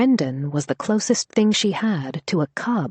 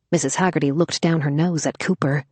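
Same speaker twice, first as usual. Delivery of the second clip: plain reading voice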